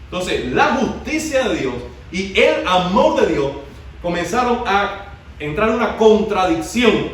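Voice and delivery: rising, urgent sermon voice; fiery preaching. Acted. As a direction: preach it out to the whole church. Preacher builds to full force.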